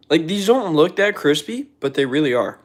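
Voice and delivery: loud, high, eager; excited. Real, not acted. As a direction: insist it with excitement. Excited about crispy fries.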